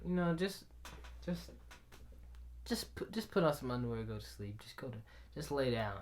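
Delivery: low, very soft coaxing; quiet soothing stepmom. Retold or imitated. Stepmom to crying boy at bedtime, soft low soothing.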